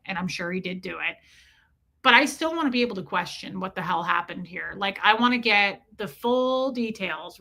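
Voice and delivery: ordinary delivery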